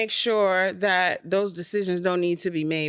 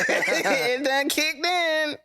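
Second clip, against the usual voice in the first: slow, laughing, high; laughing drawl; 8 semitones higher, much slower than usual. Shown as a demonstration. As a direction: stretch it out through a laugh. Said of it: "laughs, repeats drawn out".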